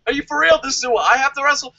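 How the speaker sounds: high, indignant mock rant; mock outrage. As a pissed-off Samoa Joe, a high mock rant. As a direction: rant like a snubbed wrestler. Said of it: "I'd be like".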